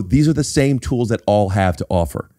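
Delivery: ordinary talk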